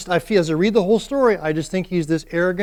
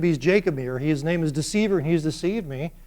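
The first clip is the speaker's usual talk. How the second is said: ordinary delivery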